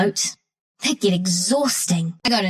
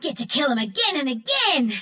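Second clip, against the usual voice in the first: ordinary talk, nothing marked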